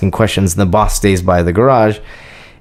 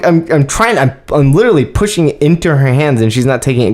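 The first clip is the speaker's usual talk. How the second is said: ordinary talk, nothing marked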